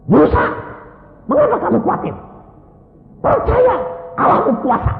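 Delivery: high, loud exhortation, word by word; rousing call. Acted. Preacher voices a call to Musa to trust God, rousing and high. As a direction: call out with force, high-pitched.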